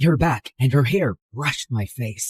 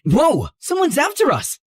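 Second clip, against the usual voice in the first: alarmed; 10 semitones higher than usual. Acted. Car rammed from behind.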